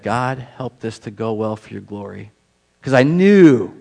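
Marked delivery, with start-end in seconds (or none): 0.0-2.3 softer, slow hushed plea; quiet prayer. Retold or imitated. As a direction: murmur a quick prayer before answering. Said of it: "I said".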